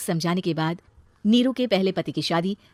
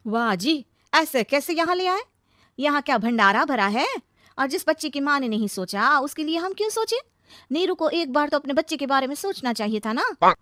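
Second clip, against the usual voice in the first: high, sarcastic protest; raised, put-out sister-in-law; indignant objection; 6 semitones higher than usual. Acted. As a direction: object sharply to the family. Sister-in-law objects to taking child.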